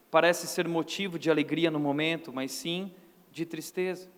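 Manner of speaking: normal talking voice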